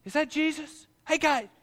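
high, loud calls across the water; yelling from shore. Retold or imitated. Man on the shore hailing Jesus, loud high yell. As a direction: shout his name from the shore. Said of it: "somebody yells from the shore".

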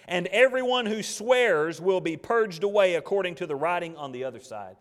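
loud, high, full-force pulpit reading; preaching scripture. Acted. Preacher thunders God's word to the congregation, loud and high. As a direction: proclaim the verse to the whole church.

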